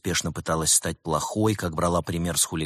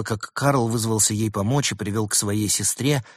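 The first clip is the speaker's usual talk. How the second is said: ordinary delivery, nothing marked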